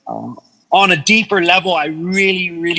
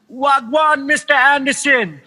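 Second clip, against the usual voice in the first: put-on lightly Jamaican-accented greeting, pitched higher; a hint of a Jamaican accent, bright hail; 7 semitones higher than usual. Jamaican FedEx driver greeting his friend, light Jamaican accent, higher. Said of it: "the Jamaican guy goes".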